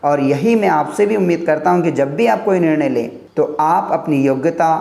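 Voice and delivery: ordinary talk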